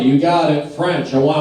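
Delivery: ordinary delivery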